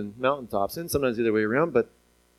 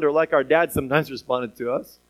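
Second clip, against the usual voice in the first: usual speaking voice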